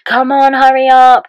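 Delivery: loud, high drunken shout imitation; mock a drunk partner yelling through the door; slurring drunk. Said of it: "like, slurring his words".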